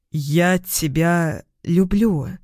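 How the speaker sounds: slow, laboured confession; forced, reluctant. A narrator's reading. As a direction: squeeze each word out unwillingly. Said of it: "Слова даются тяжело". (с трудом, медленно выдавливая слова)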